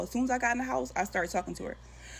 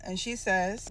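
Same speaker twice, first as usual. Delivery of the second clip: ordinary delivery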